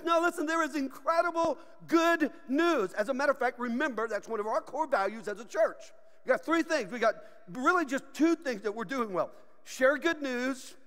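ordinary delivery

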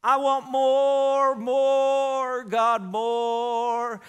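mock pleading, loud and drawn out; greedy prayer. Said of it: "Pentecostal people tend to say".

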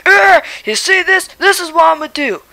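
cranky, hard-of-hearing grandpa voice; a deaf old dad barking back; grumpy old man. Acted.

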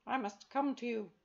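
stern talking cat; a proud cat, loud and firm. A narrator's reading. Carbonel the cat speaks.

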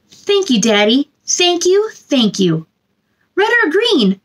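gentle, sing-song storybook reading; reading to children. Acted. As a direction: read it warmly to a little one at bedtime. Reading picture book to children.

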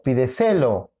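"pídeselo" stressed as pi-de-SE-lo; stress on third syllable. Shown as a demonstration. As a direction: move the accent of "pídeselo" onto SE. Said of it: "¿Cuál es?". («pídeselo» con acento en SE: pi-de-SÉ-lo)

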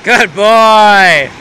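reward the dog with your voice; dog praise. Real, not acted. Owner praising the dog after a fetch, bright sing-song.

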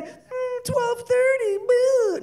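mock sleepy late riser, high and drowsy; lazily mock someone rolling out of bed at noon; lazy sleepy groan. Mocking childless audience as a lazy late sleeper.

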